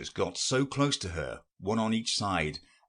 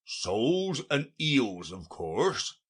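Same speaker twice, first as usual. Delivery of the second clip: slow, put-upon answer; as if it were obvious; rather impatient; 5 semitones higher, slower than usual. A narrator's reading. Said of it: "replied rather impatiently".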